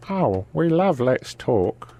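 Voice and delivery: slow mock regional English voice; put-on English accent. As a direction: stretch it out in the silly accent. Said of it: "You have to do it in that voice".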